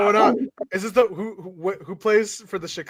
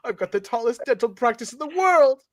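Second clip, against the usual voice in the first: mock dentist; 9 semitones higher, faster than usual. Reading dentist character, high and fast.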